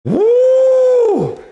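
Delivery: very high, loud whoop of joy. Real, not acted. Streamer cheering a huge price jump, whooping. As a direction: belt out a celebratory whoop. Stock surges again.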